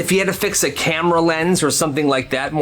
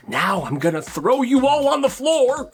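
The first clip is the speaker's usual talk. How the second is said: higher, sing-song villain voice; mock rhyme; 9 semitones higher than usual. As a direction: chant it like a cartoon villain. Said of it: "she didn't make that little rhyme".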